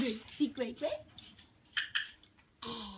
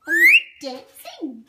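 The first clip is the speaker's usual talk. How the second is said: high, much louder squeal at the bird; excited high call; 16 dB louder than usual. Real, not acted. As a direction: cheer the bird on, shrill with delight. Baby talk, cheering bird to dance.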